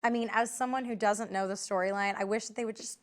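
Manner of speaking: ordinary delivery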